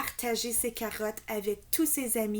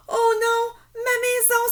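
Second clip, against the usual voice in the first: much higher, louder cry of dismay; exclaiming; 13 semitones higher, 11 dB louder than usual. A narrator's reading. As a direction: cry out as the house falls. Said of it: "s'exclame-t-il". (exclamation affolée, très aiguë)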